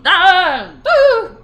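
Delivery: high, loud yelp then a boo; loud tic yelps. Real, not acted. Tics interrupting a booking call, loud high yelps. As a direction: burst out in two high cries.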